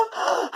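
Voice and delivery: sharp startled gasp; acted gasp of fear; frightened gasp. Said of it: "he got so scared".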